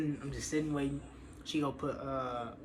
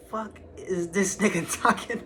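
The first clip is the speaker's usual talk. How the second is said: laughing; 4 semitones higher, 5 dB louder, slower than usual. Retold or imitated. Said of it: "we laughing".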